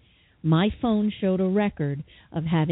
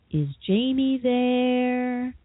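put-on slow French accent, drawing out the word there; a faint French-accented voice calling, stretching each there; French-accented ghostly voice; 3 semitones higher, much slower than usual. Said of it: "the voice had an accent".

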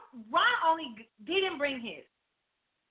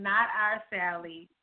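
sweet voice; 7 semitones lower, slower than usual. Retold or imitated. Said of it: "I can hear your sweet voice saying".

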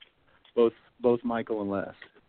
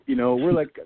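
ordinary delivery

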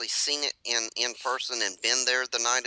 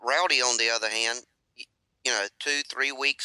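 ordinary delivery

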